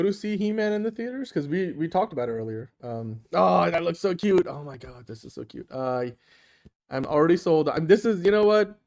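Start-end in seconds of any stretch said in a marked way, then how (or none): 4.5-5.7 soft cooing. Real, not acted. Cooing over a cute puppy.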